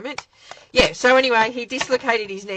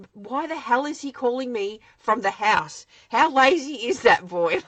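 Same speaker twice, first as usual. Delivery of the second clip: mock exasperated mum; 5 semitones higher, 6 dB louder than usual. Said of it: "mum of the year here going".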